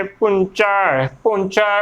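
ਪੁੰਚਾ ending on a wide, full vowel; full-voiced vowel. Shown as a demonstration. Teacher modelling the word, full pronunciation. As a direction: fill out the last vowel of ਪੁੰਚਾ. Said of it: "ਭਰ ਕੇ ਬੋਲਣਾ".

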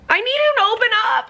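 high, fast and exasperated; frustrated shout. Real, not acted. Reader yelling at the book character to open up, exasperated shout. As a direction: yell it at the book like the character can hear.

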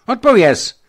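very high, loud demand; stern shout. A narrator's reading. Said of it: "zvolal ještě přísněji". (přísný, hlasitý rozkaz)

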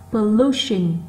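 LU in "pollution" punched; stressed second syllable. Shown as a demonstration. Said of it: "nó to hơn những âm còn lại". (nhấn mạnh âm hai của "pollution")

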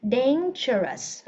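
DANGEROUS very slow, "dayn-juh-rus"; correct dangerous. Shown as a demonstration. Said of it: "Mình đọc là dangerous". (đọc dangerous thật chậm, từng âm tiết)

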